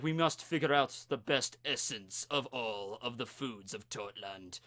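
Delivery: low, deep mock Russian accent; hammy Russian villain voice; bad Russian accent. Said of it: "I'm speaking in a really bad Russian accent for him".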